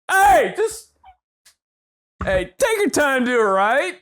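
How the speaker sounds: hammed-up dad scolding over the yanked cord; mock dad lecture. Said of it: "So I'm like".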